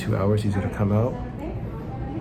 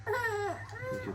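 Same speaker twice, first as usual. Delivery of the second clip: crying; 11 dB quieter than usual. Real, not acted. Prayer breaking into tears.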